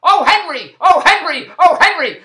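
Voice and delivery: hollering. Retold or imitated. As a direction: holler it through the thin wall. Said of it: "all night she was hollering".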